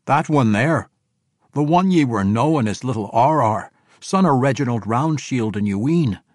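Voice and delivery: measured dwarf proclamation; dwarf king announcing. A narrator's reading. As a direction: present him to the table. Conrad begins his announcement.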